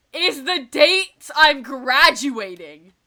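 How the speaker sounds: slow, loud, high frustrated wail; exasperated outburst. Real, not acted. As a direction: stretch each word out in loud despair. Graduation clashes with TwitchCon.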